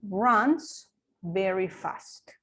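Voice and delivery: very slow, each word set apart; teacher's slow example; slow model sentence. Shown as a demonstration. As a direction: dictate it slowly for students. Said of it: "Entonces yo diría".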